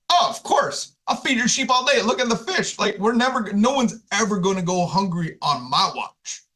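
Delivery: high, eager promise; eager Peter. Acted. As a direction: jump at the chance to please him. Peter eagerly agrees to feed sheep.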